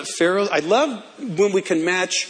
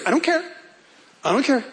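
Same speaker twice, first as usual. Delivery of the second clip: dismissive; 7 semitones higher, faster than usual. Retold or imitated. Joseph brushing off objection.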